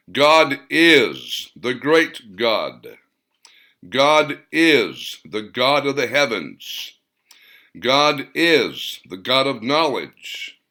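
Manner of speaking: stately, drawn-out pronouncements, each line carried; solemn announcement. A narrator's reading. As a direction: declare it slowly like a heavenly choir. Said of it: "different voices announced the names of God".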